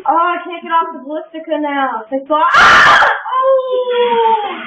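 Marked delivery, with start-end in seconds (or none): 2.5-4.6 high, loud scream into a long "oh"; scream, then wail a long high "oh". Real, not acted. Player stuck on the ballistica, high loud screaming.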